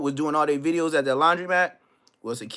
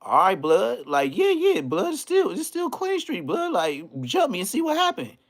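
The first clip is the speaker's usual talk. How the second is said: mock phone challenge; 6 semitones higher than usual. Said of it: "call and be like".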